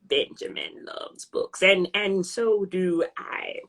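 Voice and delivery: low, slow, half-whispered poet's voice; Maya Angelou voice. Retold or imitated. Answering chat questions in a slow, low Maya Angelou voice. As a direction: speak like a grand old poet, hushed and low. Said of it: "And with your Maya Angelou voice".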